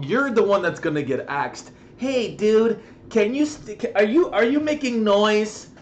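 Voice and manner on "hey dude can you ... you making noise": higher and louder accusing; hammed-up complaining neighbour; mock neighbour complaint. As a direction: imitate her nagging. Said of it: "neighbour complaining".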